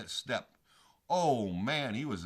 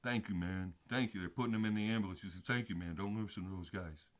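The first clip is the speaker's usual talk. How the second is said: lower, softer, faint thanks of a hurt man; weak thanks; 7 semitones lower, 6 dB quieter than usual. Retold or imitated. Injured biker thanking his rescuer, low and faint. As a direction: thank him weakly from the stretcher. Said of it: "the guy's like".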